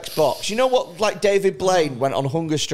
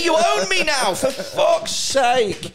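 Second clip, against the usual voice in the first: higher, hammed-up indignant scolding; indignant rant; 7 semitones higher, slower than usual. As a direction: play the caged man outraged at the heckler. Caged man scolding the passer-by.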